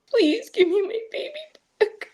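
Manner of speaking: high, breaking sob of a plea; crying plea. Real, not acted. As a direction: beg through real tears. Cries recalling begging at the door.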